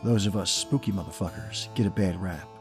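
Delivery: usual speaking voice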